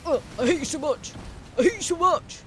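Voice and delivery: loud furious cursing at the boss; real rage at Valeria; angry shout. Real, not acted. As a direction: yell at her in anger. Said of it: "I'm angry".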